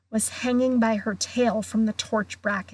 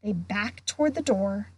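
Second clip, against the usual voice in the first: usual speaking voice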